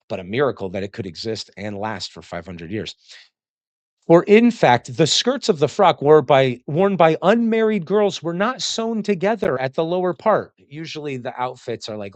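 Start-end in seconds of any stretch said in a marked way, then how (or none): 4.1-10.5 louder, declaiming text reading; formal reading. Acted. Lecturer reading Plutarch to students, loud declaiming. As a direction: read the passage out boldly to the class.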